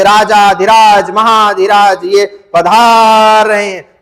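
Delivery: slow, stretched-out court announcement; royal herald's call. Shown as a demonstration. Herald in Akbar's court announcing the king. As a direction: announce the emperor with long pulled vowels. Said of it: "उसमें कहते हैं ना कि".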